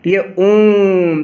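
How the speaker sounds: ओम with the vowel held three beats; prolonged vowel demo. Shown as a demonstration. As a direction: stretch ओम very slowly. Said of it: "यही प्लुत स्वर का मतलब".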